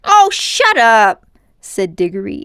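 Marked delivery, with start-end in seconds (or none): 0.1-1.3 high, loud, slow snap; boy fed up with his uncle, high and loud; angry boy. A narrator's reading. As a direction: bark it at him.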